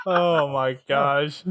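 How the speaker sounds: very slow, laughing between words. Real, not acted. Host to the panel, slow and laughing. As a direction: drag it out while laughing.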